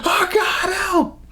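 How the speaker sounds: high mock scream for help; imitated scream. Retelling the movie, imitating the scream for help, high and desperate. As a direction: pitch it way up like a desperate cry. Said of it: "like, screaming".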